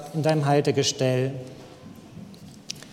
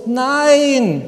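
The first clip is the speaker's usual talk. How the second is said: put-on frightened child's no; 7 semitones higher, 6 dB louder than usual. Child refuses again.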